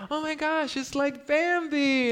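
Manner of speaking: very high, loud, drawn-out squeal of delight; mock gushing awe. Brooklyn man tells crowd of his first deer, mock gushing awe. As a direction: gush like a kid seeing a cartoon animal. Awed city kid seeing a deer.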